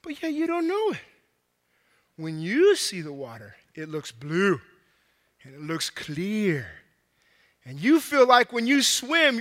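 usual speaking voice

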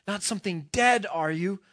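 high, loud frightened pleading, short of a scream; near scream. Acted. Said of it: "almost in a scream".

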